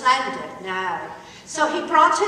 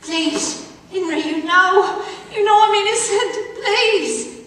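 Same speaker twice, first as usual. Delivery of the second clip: higher, louder mock plea; begging and pleading; 6 semitones higher, 4 dB louder than usual. Catherine begging Henry at the chapel, pleading. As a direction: plead for your life, hammed-up. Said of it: "begging and pleading".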